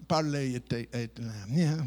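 ordinary talk, nothing marked

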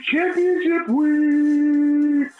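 high, slow hyped announcer. Acted. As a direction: announce it like a big event. Host hypes the league event.

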